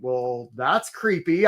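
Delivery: the drowning company eyeing a needy applicant, slow disdain; put off company. Retold or imitated. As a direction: pull back, mildly creeped out. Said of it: "the company's in the water saying".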